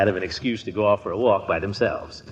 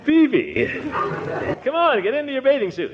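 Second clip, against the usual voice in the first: hearty calling; 14 semitones higher than usual. Acted. Calling Peavy to swim.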